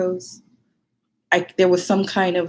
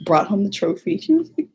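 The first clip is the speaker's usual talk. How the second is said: normal talking voice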